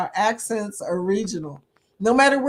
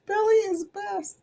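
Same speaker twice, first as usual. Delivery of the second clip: higher, playful echo; playful sing-song; 10 semitones higher, 4 dB quieter than usual. Acted. Streamer echoing a viewer's comment, playful sing-song. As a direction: toss the line back in a teasing lilt.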